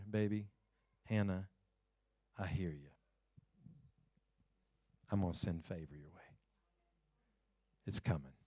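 low, soft, hushed reassurance; tender father. Acted. God as father comforting Hannah, soft and tender. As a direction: speak gently like a loving father.

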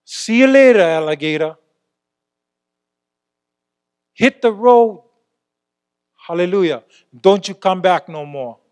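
loud, dismissive send-off, partly shouted; telling off demons. Acted. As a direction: shoo the demons away with a mocking farewell. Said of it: "That's what you're saying to the demonic forces".

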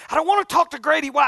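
fast, high, loud outburst; retold shout of refusal; 1 angry shout.

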